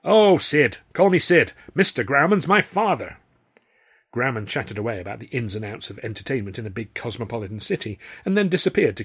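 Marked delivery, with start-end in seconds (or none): 0.0-3.2 much higher, louder friendly impresario; jovial showman. A narrator's reading. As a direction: wave off the formality cheerily. Grauman's jovial reply.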